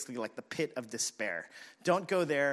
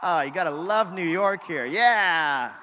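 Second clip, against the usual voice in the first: raised over siren; 3 semitones higher, 11 dB louder, slower than usual. Real, not acted. Talking over a passing siren.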